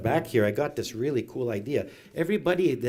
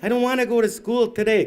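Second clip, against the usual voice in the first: high, loud mock child protest; whining child; 9 semitones higher, 7 dB louder than usual. Child to grandmother about school, high loud complaint. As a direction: protest like a kid refusing to get up.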